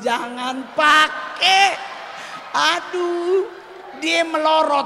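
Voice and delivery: high, loud, mock-desperate wailing; panicked wailing. Comic wails to the audience about the slipping koteka, high and loud. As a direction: wail it like a man mortified on stage. Comic embarrassment rant, shouting.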